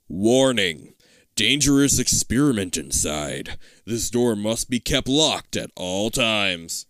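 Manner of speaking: low, slow, weighty reading; grave warning sign. Acted. Sign on a forbidden door, grave warning. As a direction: read it slowly like a stern warning.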